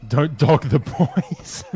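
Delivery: slow, drawn-out, giggling; laughing quote. Real, not acted. Reading a joke Gandhi quote, slow and giggling. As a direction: stretch each word while laughing.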